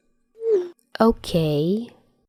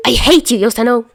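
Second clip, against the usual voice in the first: angry outburst; 6 semitones higher, 4 dB louder, faster than usual. Acted. Chuya exploding at group chat.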